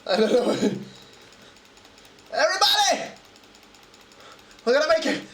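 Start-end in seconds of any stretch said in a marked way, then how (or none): 2.3-5.2 high rallying call to the crew; rallying captain. Pretend. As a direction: rouse the whole crew.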